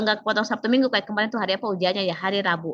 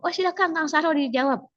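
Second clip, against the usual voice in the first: much higher, gracious examiner voice; a polite examiner granting the turn; examiner permitting; 7 semitones higher than usual. Retold or imitated. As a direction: examiner letting Sahrul answer, higher and gracious. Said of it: "perhatikan intonasi penguji".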